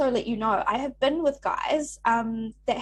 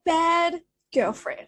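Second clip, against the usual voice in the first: slow, higher, louder mock verdict; drawn-out teasing judgement; mock scolding verdict; 7 semitones higher, 5 dB louder, much slower than usual. Real, not acted. Mock verdict on friend's story.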